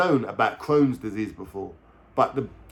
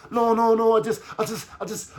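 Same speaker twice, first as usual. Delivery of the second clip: mock girlfriend protesting; 11 semitones higher than usual. Girlfriend excusing her relapse, high mock voice trailing off.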